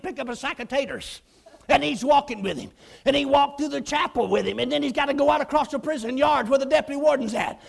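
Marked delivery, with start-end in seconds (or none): none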